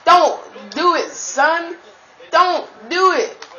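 high, urgent pleading at the screen; pleading yell. Real, not acted. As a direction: beg him not to do it.

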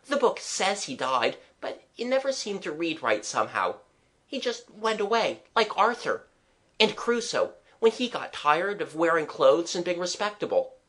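put-on earnest child. Child telling the artist about storybook heroes, earnest. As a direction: explain with a child's conviction.

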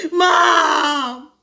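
loud, drawn-out wail for mom; sobbing cry. Acted. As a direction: cry out for your mother. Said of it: "He ran to her arms sobbing".

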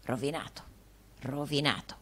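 low, hushed repetition; ominous. A narrator's reading. Ominous repeated prophecy.

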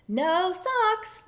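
high, loud gleeful call; playful high-pitched holler; merry duck. A narrator's reading. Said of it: "laughed Duck".

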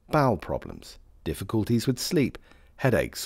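ordinary talk, nothing marked

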